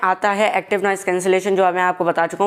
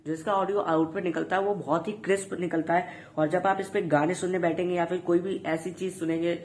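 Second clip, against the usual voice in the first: thin, distant sound of a wireless earbud microphone; through earbud mic; 8 dB quieter than usual. Shown as a demonstration. Reviewer demos his earbud mic to viewers, thin distant mic sound. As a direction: talk as if recorded on a tiny earbud mic, softer and far off. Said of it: "वो होगी मेरे earbud से".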